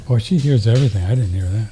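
normal talking voice

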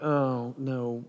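low, soft understated sigh of dismay; quietly resigned, dropping away; mild mock dismay, low and subdued; deadpan Jesus. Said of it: "you can imagine Jesus being like".

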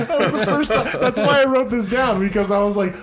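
talks through high, loud laughter; laughing hard while telling the story; high laughing, barely holding it together. Real, not acted.

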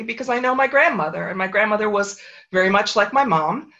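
normal talking voice

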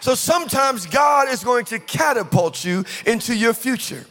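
high, ringing preacher's delivery; impassioned preaching. Real, not acted. Preacher telling church God will catapult them, impassioned.

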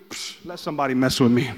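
low, cocky imitation of newfound courage; mock tough bravado. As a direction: drop it low, daring anyone. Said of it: "she went from being scared".

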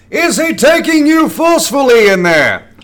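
high, slow bellow with a hammy English edge; English Rock. Acted. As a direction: roar it like a British wrestler cutting a promo. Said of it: "that was like an English rock".

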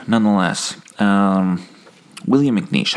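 usual speaking voice

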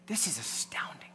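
hushed, amazed whisper; awed whisper. Real, not acted. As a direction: breathe it out in wonder. Awed aside during the story.

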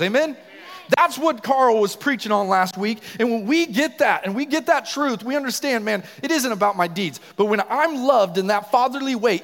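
loud, high, full-force preaching; yelling. Real, not acted. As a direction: preach it at a yell, driving every point home. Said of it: "yelling a lot".

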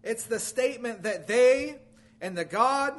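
ordinary talk, nothing marked

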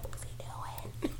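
slow whisper; whispered aside. Retold or imitated. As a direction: whisper it slowly.

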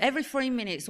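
ordinary talk

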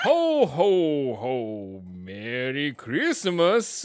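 loud, booming mock Santa in a strange Italian accent, slow on Merry Christmas; badly accented Italian Santa bellowing his greeting; Santa with an odd Italian accent. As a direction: play a cheesy Italian-accented Santa sound effect. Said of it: "it was a very weird accent".

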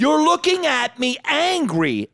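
high, drawn-out accusation; re-enacted comic outrage; exasperated accusation. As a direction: accuse the crowd slowly, pitch way up. Re-enacts confronting angry-looking audience member.

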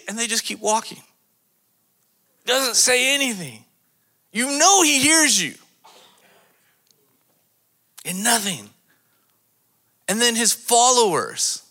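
preaching at full force; impassioned preaching. Acted. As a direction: drive it home loudly, stretching the key word.

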